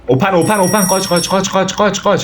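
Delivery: panicked. Real, not acted. Fleeing in panic.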